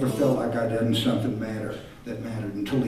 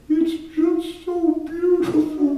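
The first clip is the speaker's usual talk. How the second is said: put-on much higher, slow child's voice; little boy; slower than usual. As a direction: say it like a moved four-year-old, slowly. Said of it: "The little boy said".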